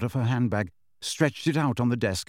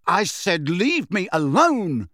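louder, higher angry shout; 6 semitones higher, 4 dB louder, slower than usual. A narrator's reading. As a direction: yell at him to back off. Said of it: "Harry shouted".

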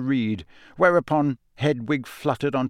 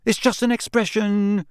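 hasty; 9 semitones higher, 3 dB louder than usual. Acted. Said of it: "said Ron hastily".

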